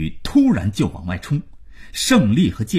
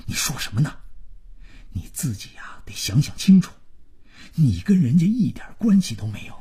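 hushed, hurried scolding that climbs in pitch; scolding father; 6 semitones higher, faster than usual. Acted. Father warning son not to upset the household, scolding. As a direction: warn him sternly, keeping the volume down.

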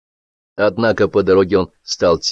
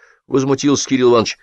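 plain reading voice

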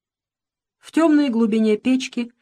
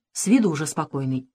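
ordinary delivery, nothing marked

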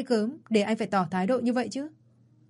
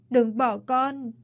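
higher, very slow, dragged-out begging; slow broken plea; 3 semitones higher, much slower than usual. Acted. Son begging his dead father, slow broken plea. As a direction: draw each word out as the grief breaks. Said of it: "ôm chầm lấy bố mà gào lên".

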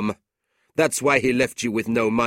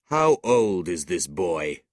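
slow, demanding uncle; 4 dB louder, slower than usual. A narrator's reading. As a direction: demand an answer, taking your time. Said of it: "he demanded".

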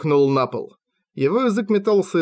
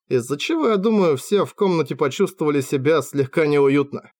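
ordinary delivery

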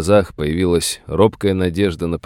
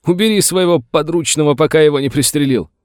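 high, loud furious outburst; yelling; 10 semitones higher, 5 dB louder than usual. A narrator's reading. Master demands the sheriff remove his deputy, yelling. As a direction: yell it with renewed force. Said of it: "заорал Саттер с новой силой".

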